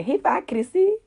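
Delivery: "hypocrisy" tried out with a questioning rise; pronunciation attempt. Shown as a demonstration. Trying to say the hard word, tentative. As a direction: sound out "hypocrisy", lifting the end as if asking. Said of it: "I'm gonna try to say".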